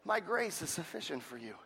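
low, soft and calm reply; God answering Paul's plea, soft and steady; gentle voice of God. Retold or imitated. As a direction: answer tenderly, like a reassuring father. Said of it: "And he says".

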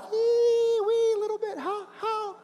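teeny mock-timid falsetto; tiny little people. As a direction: squeak like someone dwarfed by giants. Mimics tiny people beside giants.

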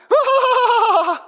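long, loud, very high wail; hammed-up cry of helpless panic; mock panicked wail. Acting out panic in the storm.

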